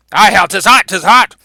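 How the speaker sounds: hammed-up protest; acted exclamation. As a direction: blurt it out as an excuse to the prince. Falstaff exclaims to the prince.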